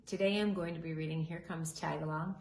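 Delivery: plain reading voice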